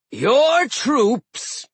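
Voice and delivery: slow booming frost giant; giant captain voice. Acted. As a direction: speak like an old ice giant. Hrym voiced.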